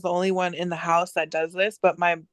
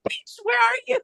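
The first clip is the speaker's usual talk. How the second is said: high-pitched mock exasperation; exasperated demand. Owner to unanswering Siri, exasperated high demand. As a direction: demand an answer in comic outrage. Scolding a phone that won't answer.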